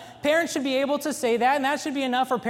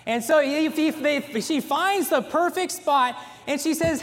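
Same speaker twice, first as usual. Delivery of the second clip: ordinary talk, nothing marked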